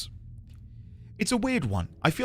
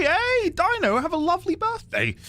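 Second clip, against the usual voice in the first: much higher, put-on cartoonish greeting; silly birthday voice. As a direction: chirp the wish like a cartoon character.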